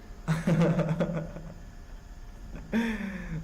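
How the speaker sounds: long, real belly laugh; amused laughter. Real, not acted. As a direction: burst out and keep laughing. Reacts to deep-voice joke.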